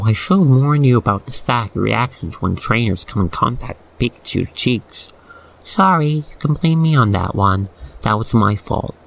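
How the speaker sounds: ordinary talk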